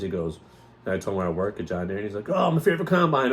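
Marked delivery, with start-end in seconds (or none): 2.3-3.3 high, eager exclamation; mock-enthusiastic reaction. Actor hearing where he works, lightly imitated enthusiasm. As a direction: gush lightly, pitched up.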